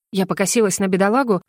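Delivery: usual speaking voice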